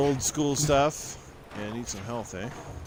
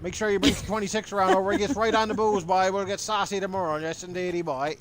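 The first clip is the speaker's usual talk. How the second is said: put-on higher, jokey Newfoundland accent; Newfie accent; 4 semitones higher than usual. Inviting his co-host out for booze, in a jokey Newfoundland accent. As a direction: ham up the Newfoundland lingo with a grin.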